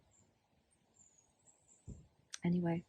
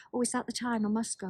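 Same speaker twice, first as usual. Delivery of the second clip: higher, louder mimicry of someone escaping; hurried polite excuse; 4 semitones higher, 5 dB louder than usual. Acquaintances fobbing her off mid-conversation, hurried polite excuse. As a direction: mimic a brisk social brush-off.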